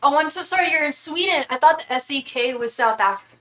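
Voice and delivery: sudden high, loud "oh" of realizing a mistake; surprised apology. Real, not acted. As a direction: catch yourself and apologize, embarrassed. Realizes viewer is in Sweden.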